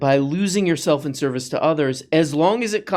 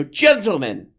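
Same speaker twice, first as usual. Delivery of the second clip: much higher, grand mock-formal greeting; mock pompous gentleman; 7 semitones higher than usual. Mocking a pompous YouTube comment, hammed-up grand greeting. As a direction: announce it like a pompous old gent.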